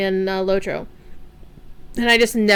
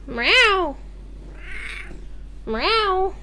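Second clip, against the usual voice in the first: imitated meow; 10 semitones higher, 4 dB quieter than usual. Meowing at the cat.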